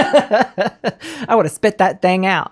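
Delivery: slow words pushed out through laughs; laughing while talking. Real, not acted. Host still amused by her gross joke, talking through laughter.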